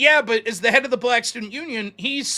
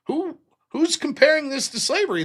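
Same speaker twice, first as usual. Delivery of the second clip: flabbergasted; 8 semitones higher than usual. Retold or imitated.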